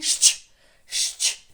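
щ alone, voiceless, loud, as ш plus ч; double щ sound. Shown as a demonstration. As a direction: hush out a forceful «шч». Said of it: "наш звук подвійний". (голосне подвійне «шч» без голосу)

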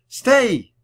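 high, loud, sharp order; shouted command. A narrator's reading. Annie orders the running puppy to stop, shouting. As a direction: yell to halt a runaway dog. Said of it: "shouted Annie".

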